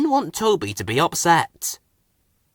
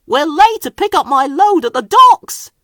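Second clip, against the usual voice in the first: higher, louder flustered engine; hasty excuse; 8 dB louder than usual. A narrator's reading. Thomas inventing a reason to leave, flustered. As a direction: cover it up fast.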